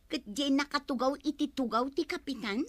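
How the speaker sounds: normal talking voice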